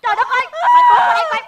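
high, loud, outraged wail; hammed-up wailing. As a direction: cry out in theatrical dismay. Owner wails after being insulted.

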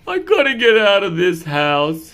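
a lightly voiced fed-up cat; bored cat.

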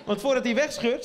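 normal talking voice

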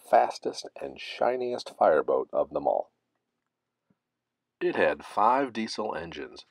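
ordinary delivery

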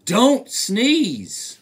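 loud, begging plea of "don't sneeze"; pleading narrator. A narrator's reading. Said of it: "oh, please".

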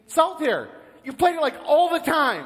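high, loud, eager reminder; insistent angel. Acted. Angel reminding the man of his favourite game, insistent. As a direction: press the point with mock excitement.

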